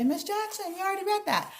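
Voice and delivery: high mock kid voice; whiny kid reminding the teacher; teacher voices a student objecting; mock student complaint. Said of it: "So if you say".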